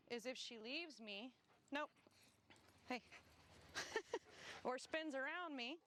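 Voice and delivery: ordinary talk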